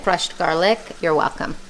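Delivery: ordinary delivery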